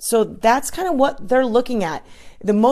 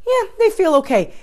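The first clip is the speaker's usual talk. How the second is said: mock casual customer; 10 semitones higher than usual. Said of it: "Or be like".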